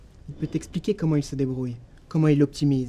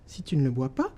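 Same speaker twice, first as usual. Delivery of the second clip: ordinary talk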